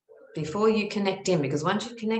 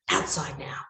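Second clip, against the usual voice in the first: stern whisper; 3 semitones lower than usual. Retold or imitated. Aunt orders her outside sternly.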